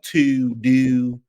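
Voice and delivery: TOO and DO with drawn-out Baltimore vowels; Baltimore accent. Shown as a demonstration. Host shows she can do the Baltimore accent. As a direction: demonstrate Baltimore vowels word by word. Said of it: "I can do the Baltimore accent".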